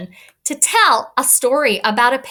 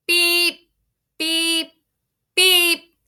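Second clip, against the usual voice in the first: horn beeps; 9 semitones higher, much slower than usual. Shown as a demonstration. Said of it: "We say those sentences loudly".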